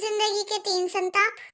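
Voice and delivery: low teasing repeat of the line; sly mocking echo. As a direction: echo the phrase back softly, teasing. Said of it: "सच ही तो बोल रही हो तुम".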